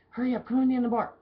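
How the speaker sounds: high, loud, fast hurry-up; urgent hurry. Retold or imitated. Bar manager rushing the bartender inside, loud fast urging. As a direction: rush her in, breathless with urgency.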